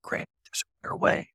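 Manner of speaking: soft unvoiced whisper from the dark; eerie whisper from the corner. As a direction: breathe it like a ghostly voice. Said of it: "a voice from the corner room goes".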